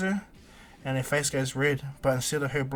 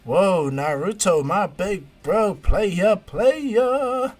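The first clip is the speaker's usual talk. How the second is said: loud, drawn-out whooping call climbing higher and higher; excited hype shout; 7 semitones higher, 10 dB louder, much slower than usual. Retold or imitated. Konohamaru interrupts to hail his big bro, excited shout. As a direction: holler it slowly, stretching every word, pitch soaring.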